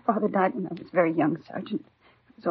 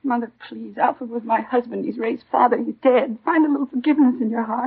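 pleading; 2 semitones higher, 7 dB louder than usual. Acted. Daughter pleads with mother, rising.

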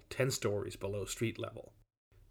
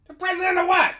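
very high, loud incredulous yell; shout across distance; 9 dB louder than usual. A narrator's reading. Boss querying the guard from afar, shouting. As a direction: yell the question back in disbelief. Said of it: "exchange across some distance".

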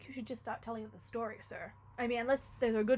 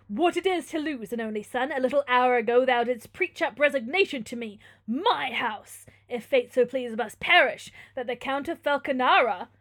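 loud, forceful declamation; vengeful Manfred; 11 dB louder than usual. Acted. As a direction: hurl it back as a pitiless lord.

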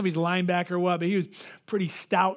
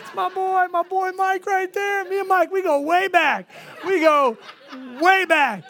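high, loud, comic boasting; over-excited mock swagger, pitched way up; giddy bragging; 11 semitones higher, 6 dB louder than usual. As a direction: brag shrilly about your big buddy. Showing off his new protector.

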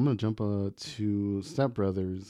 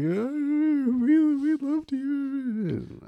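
high, very slow falsetto crowd wail; mock crowd; much slower than usual. Hurt San Diego viewers wailing at their anchorman, high mock falsetto. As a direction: stretch it out in a high mock lament.

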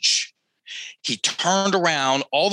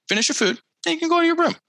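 higher, brighter finish your food, then you can go; affirmative rephrasing, pitched up; much faster than usual. Shown as a demonstration. Parent to child, saying it the positive way, higher. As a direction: lift it up into a positive. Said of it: "Repeat yourself like that".

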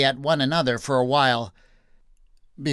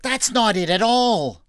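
raised, urgent pleading; higher-pitched desperate reassurance; crying out; 7 semitones higher, 3 dB louder than usual. A narrator's reading. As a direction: protest urgently, easing slightly toward the end. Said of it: "he cried out".

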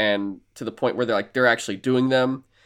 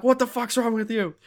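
much higher, startled shout of disbelief, imitated; alarmed outcry; 11 semitones higher than usual. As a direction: recoil from him in shock. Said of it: "they're like".

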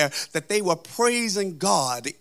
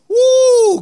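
high, sustained "woo"; preacher's whoop; 13 dB louder than usual. Real, not acted. Preacher overcome while lamenting the empty upper room, high whoop. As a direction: let out one long high whoop. Preacher exclaims in mid sermon.

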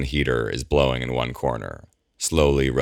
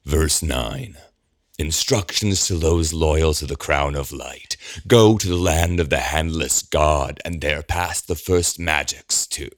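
manic prophecy; 3 semitones higher than usual. A narrator's reading. Eamon reciting scripture.

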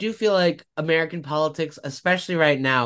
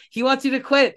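casual reporter; 11 semitones higher than usual. Retold or imitated. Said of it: "so casually was like".

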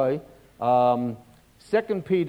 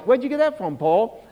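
questioning voice; 5 semitones higher than usual. Pretend. Preacher questions Paul.

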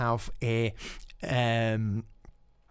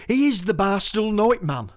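raised, declaiming the title line; poem recital opening; 8 semitones higher, 6 dB louder than usual. Shown as a demonstration. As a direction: launch the poem with a bold first line. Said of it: "It's called Barstool Know It Man".

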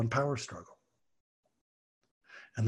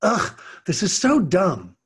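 put-on sulky teen; 5 semitones higher, 4 dB louder, slower than usual. Sulky student quoted.

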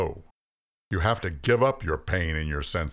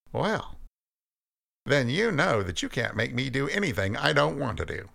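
higher, challenging voice of Cain; defiant Cain; 8 semitones higher than usual. Acted. Cain defying God, higher and challenging. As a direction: throw it back at God.

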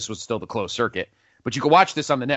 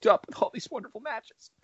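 mock choked up; 9 semitones higher than usual. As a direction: pretend to choke up with emotion. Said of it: "I'm choked".

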